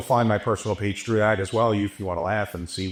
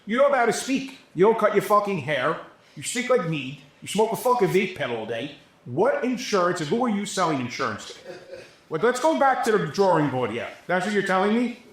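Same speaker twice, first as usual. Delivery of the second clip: retold heated tirade, pitched higher and sharper; aggravated rant; 9 semitones higher than usual. Retelling how he scolded a young crew kid, heated and exasperated. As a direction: chew the kid out again, exasperated. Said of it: "I'm getting aggravated talking to this kid".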